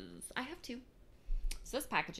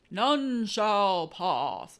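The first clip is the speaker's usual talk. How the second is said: slow booming guard voice, louder; mock gatekeeper; 11 dB louder, much slower than usual. Vlogger jokingly booms a movie guard's line. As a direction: declare it like a knight barring a bridge.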